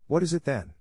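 put-on childlike questioning lift; puzzled child. A narrator's reading. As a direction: ask in innocent puzzlement. Puzzled child keeps questioning boy.

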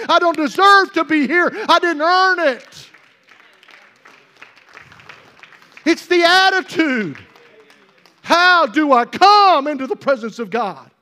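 high, loud declaration with stretched key words; impassioned preaching. Real, not acted. Preacher confessing he didn't earn God's presence, impassioned preaching. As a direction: proclaim it at full sermon force.

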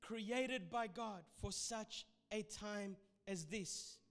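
fervent preacher, rising and loud; preaching. Acted. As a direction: preach it with full conviction. Preaching at full force.

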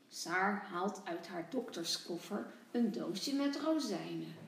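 plain reading voice

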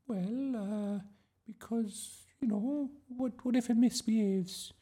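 high, halting excuses; flustered teacher. A narrator's reading. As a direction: hesitate between phrases in a very high voice. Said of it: "Miss Darling looked flustered".

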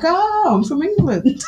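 high, drawn-out playful 'oh' answer; mock-innocent realisation. Shop worker deliberately playing dumb with customer, high mock-innocent reply. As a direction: feign sudden understanding, slowly, on the edge of laughter.

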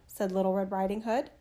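ordinary delivery, nothing marked

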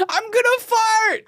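high, drawn-out mock yell; mom's panicked protest. Daughter mimicking mom escaping tickles, panicked high cry. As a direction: cry out a desperate excuse, hammed up. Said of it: "And she's like".

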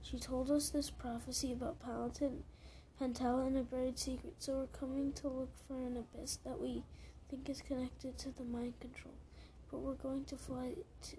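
plain reading voice

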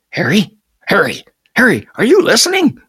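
high, loud calls building in urgency; insistent calling. A narrator's reading. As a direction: call out for an answer, pitch climbing. Caller unheard, repeats louder.

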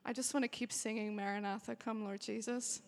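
ordinary talk

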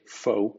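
foe said the English way; English word foe. Shown as a demonstration. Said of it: "por ejemplo". (foe en inglés)

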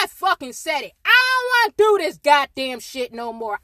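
ordinary talk, nothing marked

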